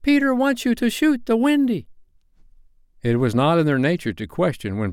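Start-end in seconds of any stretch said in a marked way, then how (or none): 0.0-1.9 very high, ringing fairy cry; shrill fairy voice. A narrator's reading. Jealous fairy giving the boys orders, shrill and ringing. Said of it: "Tink's reply rang out".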